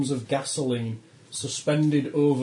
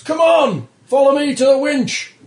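high, loud shouted order; first officer's shout; 12 semitones higher, 9 dB louder than usual. Acted. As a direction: bellow orders to the crew over the chaos. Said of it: "turns to you all and shouts".

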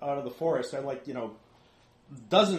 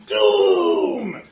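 lower, very loud, drawn-out bellow; creature's doom cry; 6 semitones lower, 14 dB louder than usual. Acted. Game master replays the creature's warning, ominous bellow. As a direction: boom it out long as an alarming omen. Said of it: "his eyes bugged out and he said".

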